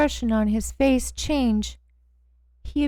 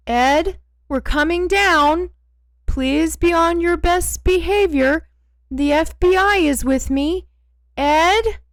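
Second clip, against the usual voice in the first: higher, louder call down the stairs; 6 semitones higher, 6 dB louder than usual. A narrator's reading. Tracy calling down the basement stairs to Ed, loud. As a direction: call down to Ed below.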